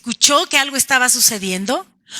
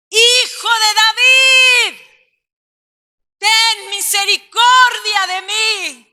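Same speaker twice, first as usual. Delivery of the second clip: loud, high, slow pleading cry; crying out louder; 9 semitones higher, 3 dB louder, slower than usual. Shown as a demonstration. Beggar crying louder after being hushed, loud pleading shout. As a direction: cry out with more force. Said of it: "clamó con mucha más fuerza".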